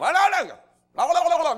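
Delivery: very high, loud gibberish yell; mock foreign yelling. Mimicking other countries' curling teams, comic high yelling. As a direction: imitate excited curlers yelling nonsense. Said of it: "'cause they're yelling".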